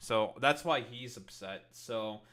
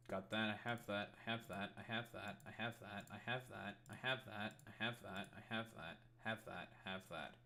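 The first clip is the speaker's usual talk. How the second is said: muttered checklist; 3 semitones lower, 9 dB quieter than usual. Real, not acted.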